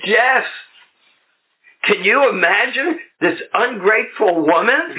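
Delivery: high, self-righteous complaint; indignant whine. Retold or imitated. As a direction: sound outraged and wronged. Complaining to sponsor.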